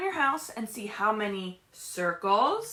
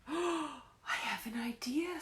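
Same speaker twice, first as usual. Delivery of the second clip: slow, hushed conspiratorial whisper; a mouse whispering a clever plan to his friends; secret whisper; 4 semitones higher, 8 dB quieter, slower than usual. Acted.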